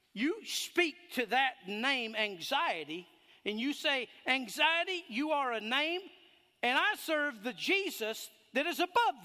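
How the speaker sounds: loud, high, driving preacher's cry; full-force preaching. Acted. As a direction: preach it out hard over the crowd.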